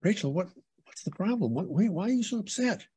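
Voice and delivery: high, pleading questions, stumbling over the repeats; retold baffled concern. Man retelling how he questioned his crying girlfriend, baffled concern. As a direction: imitate bewildered worry, pitch lifted well up.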